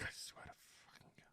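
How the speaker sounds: whispered under breath; exasperated whisper. Real, not acted. Reviewer whispering exasperation about the episode. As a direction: hiss it to yourself.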